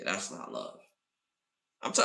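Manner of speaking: ordinary delivery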